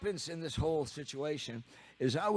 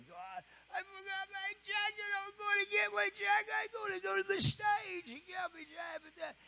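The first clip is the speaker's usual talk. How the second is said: high, frantic British shouting; a rock drummer in a panic, shouting high; 3 dB quieter than usual. Retold or imitated. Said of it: "screaming and shouting".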